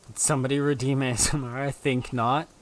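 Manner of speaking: booming put-on voice; loud mocking voice. Loud put-on voice, ASMR redeemed.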